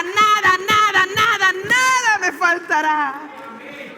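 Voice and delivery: high, loud climactic declaration; impassioned preaching. Real, not acted. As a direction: build with repeated words to a drawn-out cry. Preaches at full force.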